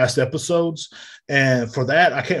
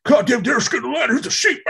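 high mocking jeer; ridiculing critic, pitched way up; 12 semitones higher, 4 dB louder than usual. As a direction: jeer at Derek in a high, nasal-free mock voice. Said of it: "acted exchange".

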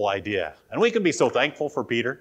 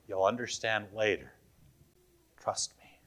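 soft and low, trust me whispered; gentle quiet reassurance; quietly; 3 semitones lower, 7 dB quieter than usual. Retold or imitated. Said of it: "And he quietly says".

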